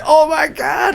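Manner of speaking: high, loud retold cry of alarm; collective shock. Recounting the cat chaos to colleague, high alarmed cry. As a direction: cry out in mock panic.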